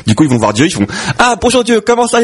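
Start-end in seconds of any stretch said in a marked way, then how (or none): 1.2-2.2 high comic hello; exaggerated polite greeting. The rabbi says hello to God in a joke, exaggerated greeting.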